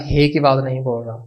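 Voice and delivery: ordinary talk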